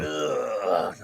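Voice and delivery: long, low disgusted "ugh"; exasperated low groan; weary groan. Real, not acted. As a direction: let out a low, drawn-out groan. Exasperated reaction to the movie talk.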